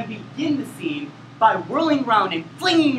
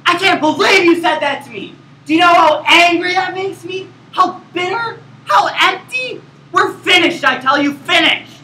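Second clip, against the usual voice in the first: high, loud hammed-up fury; emotional outburst; 7 semitones higher, 7 dB louder than usual. Shown as a demonstration. Actor demonstrates an angry outburst at his scene partner. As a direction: rage at your partner like a soap star. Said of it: "include an emotional outburst".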